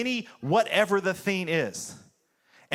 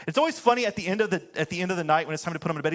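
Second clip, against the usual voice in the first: ordinary talk, nothing marked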